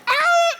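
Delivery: sharp, very high yelped bark; coyote yelp. Pretend. Preacher acts out a coyote attacking, high yelp. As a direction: snap out a yipping coyote cry.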